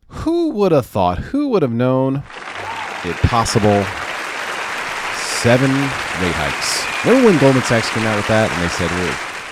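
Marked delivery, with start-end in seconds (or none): 0.1-8.5 sarcastic. Real, not acted. Mocking a forecast that came true.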